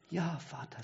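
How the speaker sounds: soft, careful reply; hushed and cautious, as if not to disturb; quiet and careful. A narrator's reading. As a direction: son answering his dying father softly and cautiously. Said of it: "sagt er leise und vorsichtig".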